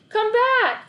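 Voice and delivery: high, loud plea; boy begging his dog to come back; calling out. A narrator's reading. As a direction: shout for him to return.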